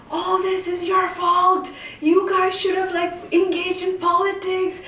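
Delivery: high, heated accusations at the elders; furious rant. Retold or imitated. Young activist losing it at community elders, furious. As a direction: blast them for their failure. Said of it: "I was, like, losing it".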